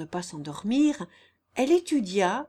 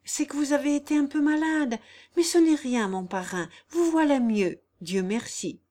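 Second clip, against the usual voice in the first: lightly higher-voiced devoted girl; anxious, soothing Jeanne; 7 semitones higher, 5 dB louder than usual. Jeanne comforting her sick godfather, soothing. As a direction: reassure the sick godfather in a raised voice.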